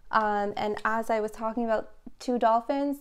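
usual speaking voice

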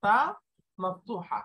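ordinary talk

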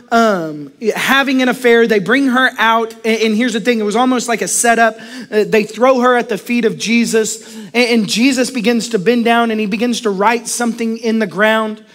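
ordinary delivery

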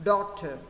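"Daughter" with the ending ter clearly sounded; don't let ter fade in "Daughter"; fuller final syllable. Shown as a demonstration. Teacher corrects a weak ending, "Daughter" with fuller ter. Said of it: "Chữ thơ hơi yếu nhé".

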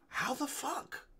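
very high, hushed incredulity; mock-stunned, soft high voice; retold inner disbelief. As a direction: squeak the thought out softly. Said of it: "I'm thinking that while he's talking".

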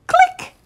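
high bright camera click. Acted. Reader makes the camera's click sound. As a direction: chirp the click like a tiny shutter.

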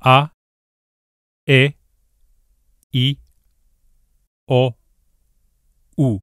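"A, E, I, O, U" as short pure vowels, no glides; each vowel clipped and pure, no diphthong; five Spanish vowels, steady and short. Shown as a demonstration. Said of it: "Can you repeat after me?".

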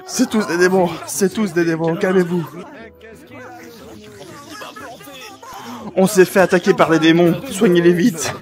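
loud urgent calls; frantic pleading. Acted. Zenitsu urging the healers after the demon attack, loud urgent call. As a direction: push them to act fast.

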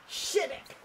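high breathy whisper for the cat; cat's line whispered high; whispered shut up. Pretend. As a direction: hiss Shut up softly as the cat.

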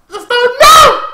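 sudden high-pitched shout; excited yell. Real, not acted. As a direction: burst out loud and high. Outburst while reacting to anime.

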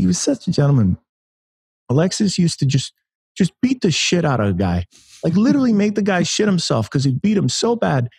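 high-pitched bad boxer impression; Mike Tyson. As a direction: badly imitate a famous boxer, holding the voice way up high. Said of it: "that's my terrible Mike Tyson impression".

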